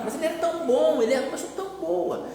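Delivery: high, soft quoted voice; imitated admirers. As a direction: mimic people praising someone, high and softly. Acting out people praising the deceased.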